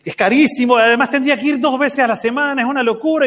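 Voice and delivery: protesta aguda y sonora; protesta imitada. Retold or imitated. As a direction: imita al papá indignado por el precio. Papá protesta por el precio. (queja exagerada)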